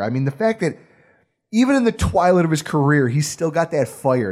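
ordinary talk, nothing marked

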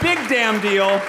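big emphatic boast; loud. Acted. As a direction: punch it out loud. Emcee punchline shout.

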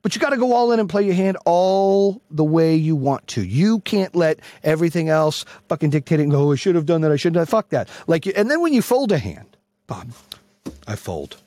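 ordinary delivery

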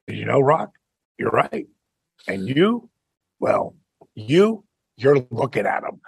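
understated deadpan comeback with pauses. Retold or imitated. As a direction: deliver it dead straight, pausing between pieces. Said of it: "And I just deadpanned him".

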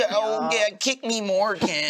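high, eager punching-bag voice; Wormmon. Acted. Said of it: "I'll always be there for Wormmon".

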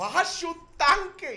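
high, slow excited yell; excited shout. Pretend. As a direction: yell it out in surprise.

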